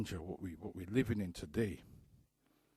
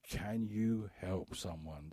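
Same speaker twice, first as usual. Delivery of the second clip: slow and deliberate; 3 semitones lower, much slower than usual. Real, not acted. Repeated slowly and lower.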